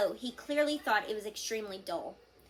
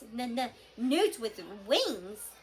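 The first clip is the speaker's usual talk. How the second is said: slower, louder offended stammer; indignant stammer; 3 dB louder, slower than usual. A narrator's reading. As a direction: stretch out the insult, hurt. Toothless repeats again, offended.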